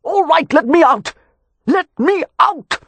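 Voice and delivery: high, loud panicked pleading; terrified cry. A narrator's reading. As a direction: scream to be let out of the fire. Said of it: "a terrified cry".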